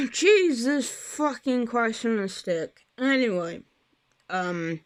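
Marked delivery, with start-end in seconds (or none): none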